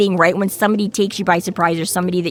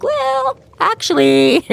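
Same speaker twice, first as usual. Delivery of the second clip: a sudden much higher well, then actually; sheepish backtrack; 11 semitones higher, much slower than usual. Retold or imitated. Harry admitting he still needs help, high sheepish backpedal.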